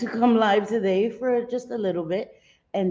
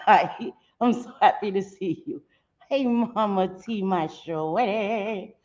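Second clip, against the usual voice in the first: ordinary delivery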